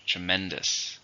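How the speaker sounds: "Tremendous" stressed on MEN; stress on second syllable. Shown as a demonstration. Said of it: "stresses on the second".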